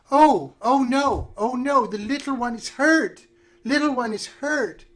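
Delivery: put-on high, slow, fretting old witch; worried witch voice. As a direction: coo over the hurt little one, high. GM voices the witch finding Grutz hurt.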